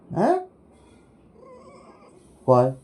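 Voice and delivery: ordinary talk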